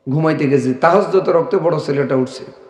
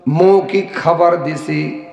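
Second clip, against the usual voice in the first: ordinary delivery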